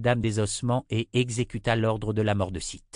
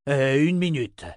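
raised, loud objection; interjecting; 5 semitones higher, 6 dB louder than usual. A narrator's reading. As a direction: cut in to halt everyone. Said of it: "lança Chase en saisissant".

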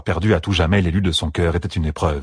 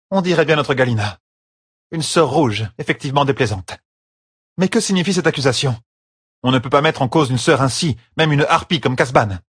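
higher-pitched voice of an affronted sister; stiff, indignant Séonide; 8 semitones higher than usual. Acted. Séonide questions the accusation against a sister, stiffly indignant. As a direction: object firmly, in a raised pitch.

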